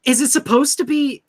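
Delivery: ordinary delivery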